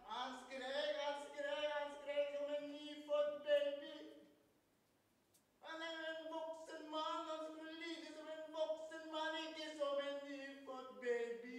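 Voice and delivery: loud, high wailing narration; screamed telling. A narrator's reading. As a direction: build to a high, drawn-out outcry. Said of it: "så skrek han".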